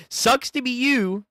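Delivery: sing-song high taunt; mocking high taunt. Taunt repeated.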